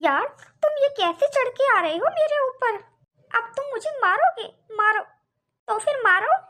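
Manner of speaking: high-pitched, indignant marital quarrel; worked-up, high-voiced protest; indignant challenge. Wife confronts husband threatening her.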